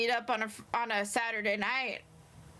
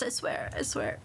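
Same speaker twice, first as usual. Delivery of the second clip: ordinary talk, nothing marked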